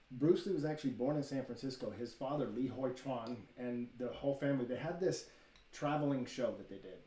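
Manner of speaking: ordinary delivery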